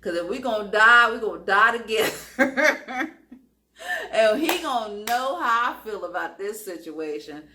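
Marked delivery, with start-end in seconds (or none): 0.0-3.2 building to a loud snap; louder, snapping. Retold or imitated. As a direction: she decides to stop being calm and lets him have it, louder. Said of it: "click the hell out".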